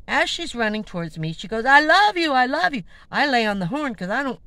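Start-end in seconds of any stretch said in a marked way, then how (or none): 1.7-2.8 higher and louder, imitating a frantic stranger; mock scream. Vlogger imitating the woman screaming at her van, loud mock cry. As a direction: yell it at someone running toward you. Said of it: "someone screaming at me".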